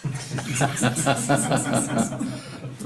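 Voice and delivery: hearty real laugh; burst of laughter. Real, not acted. Laughing at cartoon take.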